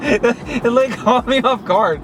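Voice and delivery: thrilled and breathless, pitched high; excited, laughing. Real, not acted. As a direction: ride the adrenaline rush, laughing. Thrill of sport boost launch.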